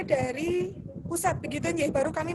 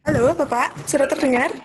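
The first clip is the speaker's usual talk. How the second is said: calling out loudly; 8 dB louder than usual. Real, not acted. Checking if heard on call.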